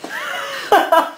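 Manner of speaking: high burst of laughter. Real, not acted. Soap maker cracking up mid-video, high laugh. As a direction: let out a high-pitched laugh.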